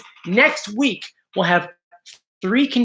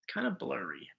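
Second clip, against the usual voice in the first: muttered whisper; 8 semitones lower, 19 dB quieter than usual. Real, not acted. Reading blurry chat to himself.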